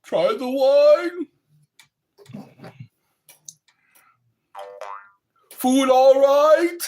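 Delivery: high, loud and slow, a hammed-up host; loud slow mock film host offering food; Clockwork Orange quote. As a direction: press the wine on him with menace, slowly. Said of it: "my favorite parts of A Clockwork Orange".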